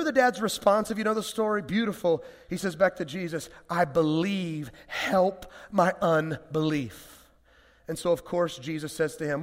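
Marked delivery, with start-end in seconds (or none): 3.7-7.3 very slow, broken plea; a father pleading, slow and strained; halting confession. Acted. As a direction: let each word cost him.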